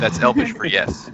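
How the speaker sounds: high joking aside; playful quip. Real, not acted. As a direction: toss it off teasingly.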